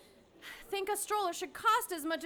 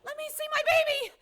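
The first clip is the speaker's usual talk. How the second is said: desperate plea; 6 semitones higher, 4 dB louder, much faster than usual. Acted. Desperate mother.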